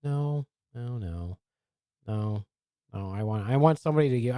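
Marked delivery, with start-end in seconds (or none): none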